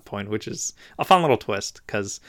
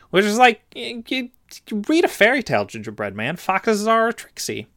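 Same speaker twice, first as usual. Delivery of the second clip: exasperated; 10 semitones higher than usual. Real, not acted. Scolding the story character.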